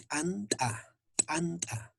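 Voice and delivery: "anta" with a popped t' before each vowel part; explosive T. Shown as a demonstration. Teacher models correct T apostrophe, explosive "anta". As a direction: pop a hard t' to start "anta" and again inside it. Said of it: "T con una explosión".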